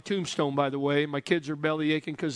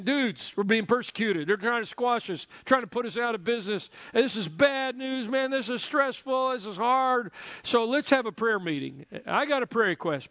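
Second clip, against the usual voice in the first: higher, worked-up surfer-dude Peter; stressed-out Peter; 5 semitones higher than usual. Acted. Peter telling the disciples he's stressed, higher and urgent. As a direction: play Peter fretting about persecution. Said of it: "Peter says".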